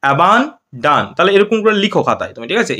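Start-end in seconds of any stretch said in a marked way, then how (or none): 0.0-1.0 "abandon" very slow, split before the last syllable; broken into parts. Shown as a demonstration. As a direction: break "abandon" into two drawn-out chunks, lower. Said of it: "abandon মানে ভেঙে ভেঙে".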